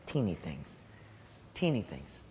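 very low, soft repetition; hushed emphasis. Acted. Preacher answering through what the work began, hushed. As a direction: drop right down to something tiny.

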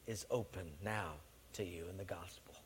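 hushed whisper of revelation; awed whisper. Acted. Servant whispering to Livingstone that the Congo is open. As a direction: breathe it like a secret. Servant reveals scepter's meaning.

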